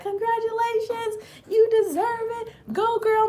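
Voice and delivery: high, bright imitation of cheering fans. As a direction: squeal their praise up high. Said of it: "y'all just been like".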